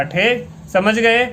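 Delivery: ordinary talk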